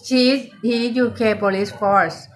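ordinary talk, nothing marked

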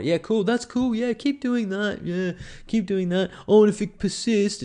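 high, slow, dismissive doctor voice; sing-song fobbing off of a patient; mocking the doctor. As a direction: play the breezy doctor brushing them off, pitched high. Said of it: "He's going".

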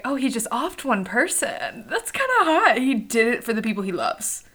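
a breathy-high swoon on hot; swooning reader. Retold or imitated. Readers swooning over a fictional killer, mock swoon. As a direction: play the smitten reader. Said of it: "we're like".

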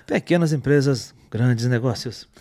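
low, imitated ad spokesman; Tony Ramos. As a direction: deliver the slogan in an imitated low actor's voice. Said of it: "tu fez o Tony Ramos falando".

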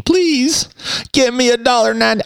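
hammed-up whimpering plea for money; mock pleading. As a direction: beg like a desperate podcaster. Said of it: "Pretty soon I'll be pleading".

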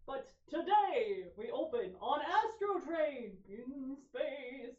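slow, loud movie-trailer drama; booming slow trailer narration; horror trailer voice. Acted. Dramatic space opening narration.

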